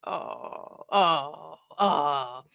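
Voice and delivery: breathy "Oh, oh, oh", recoiling in disgust; you just stepped in something gross; sinking groan of revulsion. Shown as a demonstration. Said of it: "like you've just stepped in something questionable or disgusting".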